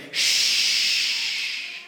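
long drawn-out shush. Retold or imitated. Preacher voicing Caleb and Joshua hushing fear, long shush. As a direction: silence fear with a long "shh". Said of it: "as they say".

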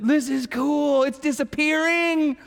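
put-on amazed little boy. Son amazed by paint pen.

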